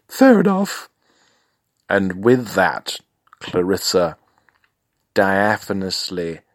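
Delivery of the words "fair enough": much higher, louder falsetto retort; indignant falsetto snap; high falsetto client. Acted. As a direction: fire it back in a squeaky falsetto. Client voiced again.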